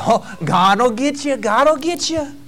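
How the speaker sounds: a lightly sing-song jeering God will get you; mild mocking tone; mock teasing. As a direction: tease like a giggling coworker. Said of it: "who giggle and joke about".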